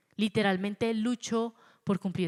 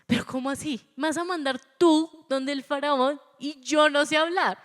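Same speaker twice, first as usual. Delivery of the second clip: higher and louder, disbelieving; incredulous protest; 8 semitones higher, 6 dB louder than usual. Acted. Moses protesting to God that he cannot speak. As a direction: push back in alarmed disbelief. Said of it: "le llega y le dice".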